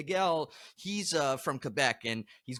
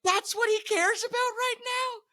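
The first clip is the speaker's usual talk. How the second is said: much higher, strained exasperated voice; mock disbelief. [name] retelling his shock at Miguel, high exasperated squeal. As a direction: squeal in disbelief at guitar players. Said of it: "I'm like".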